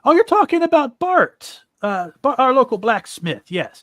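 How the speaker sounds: high, loud Irish-ish townsman; animated, not-quite-Irish character voice; almost Irish accent. Acted. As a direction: pitch up into a weird Irish lilt. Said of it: "a weird, almost Irish accent for him".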